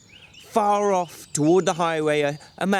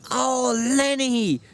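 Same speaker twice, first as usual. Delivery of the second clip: gentle; 10 semitones higher, slower than usual. Acted. George softens.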